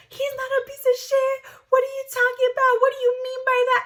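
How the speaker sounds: mock the friend getting touchy about her man. Friend quoted defending boyfriend.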